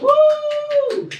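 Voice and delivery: very high, loud whooping cheer; imitated cheer. Mocking the mask-wearing crowd with a loud high whoop. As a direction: mimic the crowd cheering wildly. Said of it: "They were super excited".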